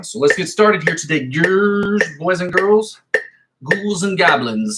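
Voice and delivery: high, drawn-out mock host greeting; playful showy intro. Guitar teacher hailing boys, girls, ghouls and goblins, playful and showy. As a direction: stretch it out like a campy show opener.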